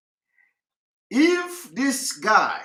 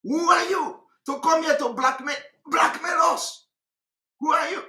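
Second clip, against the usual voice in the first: higher, indignant demand; mock indignation; 7 semitones higher than usual. Commentator imitating the poisoner's outburst, mock indignant. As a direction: throw it at her like the guilty girl did. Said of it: "react so badly".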